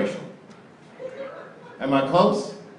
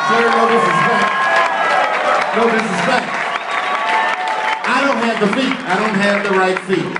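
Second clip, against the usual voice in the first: higher, louder nervous backpedal; loud defensive apology; 6 semitones higher, 7 dB louder than usual. Real, not acted. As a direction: plead no offense to the big guy. Defensive to big husband.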